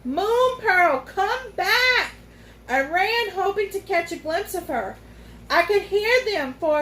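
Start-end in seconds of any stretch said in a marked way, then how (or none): 0.0-2.1 calling after her. A narrator's reading. Calling after the runaway unicorn.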